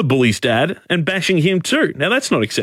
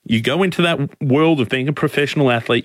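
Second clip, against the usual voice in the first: ordinary talk, nothing marked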